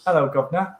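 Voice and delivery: hammed-up British greeting; British accent. Shown as a demonstration. Host trying British on request, hammed-up British accent. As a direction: put on a stock British accent. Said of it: "Oh, British".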